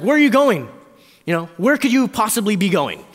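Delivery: loud, high incredulous question; exasperated parent. Acted. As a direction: call after a wandering toddler. Parent calling after toddler walking out.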